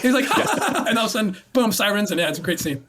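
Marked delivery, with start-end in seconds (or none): 0.3-0.8 high acted-out burst of laughter; put-on high laugh; imitated laughing. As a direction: mimic an actor cracking up. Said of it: "And he's laughing, right".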